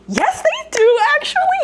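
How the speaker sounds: very high squeaky correction; mock-sweet rebuttal. Real, not acted. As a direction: sing it out high, stretched and exasperated. Sarcastic rebuttal of commenters.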